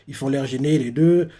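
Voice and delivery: ordinary talk, nothing marked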